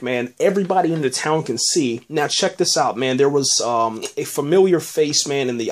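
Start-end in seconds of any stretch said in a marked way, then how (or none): none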